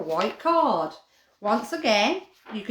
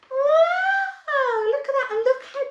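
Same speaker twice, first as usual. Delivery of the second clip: a much higher, louder wow of wonder; delighted wow; 5 dB louder than usual. Real, not acted. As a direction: gasp it out in delight. Two doggies together.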